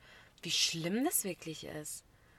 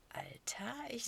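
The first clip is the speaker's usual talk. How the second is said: ordinary talk, nothing marked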